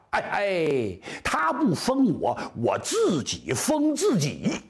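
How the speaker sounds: slow, emphatic mock royal defiance; acted indignant warlord crowning himself; defiant glaring king. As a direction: stretch each word out as a bold declaration. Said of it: "这武王一瞪眼珠子".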